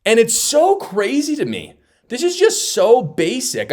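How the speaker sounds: high, exasperated disbelief. Real, not acted. Host venting to viewers, high and exasperated. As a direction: throw up your hands at how basic it is.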